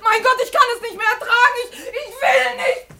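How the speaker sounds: high, sobbing outcry breaking down; hysterical sobbing. Acted. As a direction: break down, crying and shouting at once.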